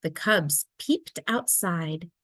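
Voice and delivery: ordinary delivery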